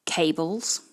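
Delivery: Cables, said KAY-bles, rhyming with table; English pronunciation. Shown as a demonstration. Teacher modelling Cables for the class, rhymes with table. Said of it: "Ça rime avec table".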